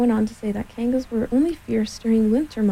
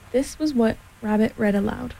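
plain reading voice

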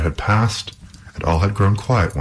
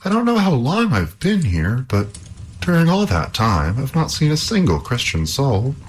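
high-pitched, amazed voice; amazed princess; 9 semitones higher than usual. A narrator's reading. Captive princess tells Halvor she has seen no one, amazed. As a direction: keep it high and incredulous.

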